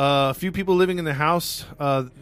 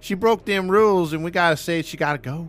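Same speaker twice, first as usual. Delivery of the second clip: mock landlady voice; 5 semitones higher, 5 dB louder than usual. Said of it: "continues landlady voice".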